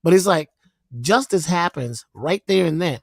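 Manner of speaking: ordinary talk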